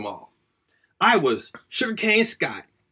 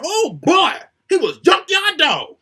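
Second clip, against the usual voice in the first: excited hype; 8 semitones higher, 4 dB louder than usual. Acted. Hyping up his hero.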